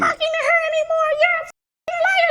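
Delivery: very high mock screech; screaming accusation. Acts woman's screaming quarrel.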